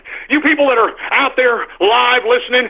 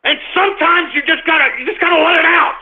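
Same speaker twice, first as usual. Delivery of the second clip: venting; 7 semitones higher than usual. Real, not acted. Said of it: "a tension breaker".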